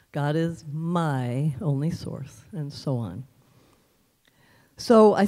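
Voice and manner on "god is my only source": "my" drawn out before a quick "only source"; stress on my. Shown as a demonstration. Showing how to change the inflection, stress on "my". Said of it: "change the inflection on the word".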